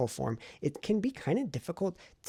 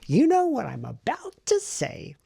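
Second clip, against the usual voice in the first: higher, louder and slower, a playful sing-song; sing-song tease; 11 semitones higher, 6 dB louder, slower than usual. Real, not acted. Vlogger teasing viewers about the writing, playful sing-song. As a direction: draw it out like a teasing singsong.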